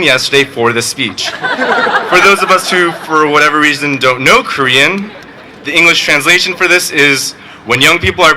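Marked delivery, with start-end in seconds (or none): none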